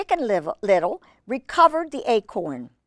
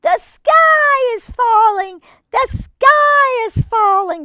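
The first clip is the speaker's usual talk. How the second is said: much higher, loud, slow panicked scream; 7 dB louder, slower than usual. Acted. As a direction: shriek it in terror.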